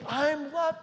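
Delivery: high, loud joyful claim; joyful declaration. Acted. John declaring he is loved, joyful. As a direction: proclaim it with joy.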